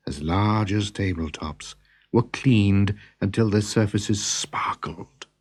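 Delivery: ordinary delivery, nothing marked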